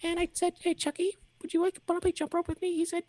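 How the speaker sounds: put-on very high squeaky little boy; little kid voice. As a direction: chatter like a small child.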